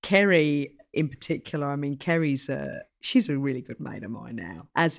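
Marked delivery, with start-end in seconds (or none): none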